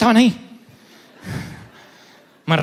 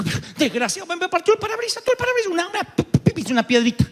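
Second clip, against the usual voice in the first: indignant rant; 11 semitones higher, faster than usual. Retold or imitated. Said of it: "Me enojé tanto".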